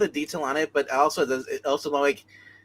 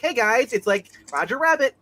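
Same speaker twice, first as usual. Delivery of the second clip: lightly voiced cartoon greeting, higher pitched; cartoon logo voice; 8 semitones higher than usual. Host mocking an out-of-place cartoonish logo, higher cartoon voice. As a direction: chirp it a little like a cartoon logo.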